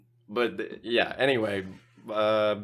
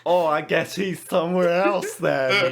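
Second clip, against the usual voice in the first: high, loud hammed-up innocence; exaggerated mock obliviousness; acting out Larry; 6 semitones higher, 5 dB louder than usual. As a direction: overplay the clueless act in a raised voice. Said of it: "he's doing it again".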